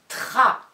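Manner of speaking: "Tra" loud, R slightly held; emphatic dictation. Shown as a demonstration. Teacher dictating the second syllable, loud and distinct. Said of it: "des dictées de syllabes".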